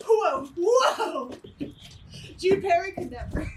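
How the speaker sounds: usual speaking voice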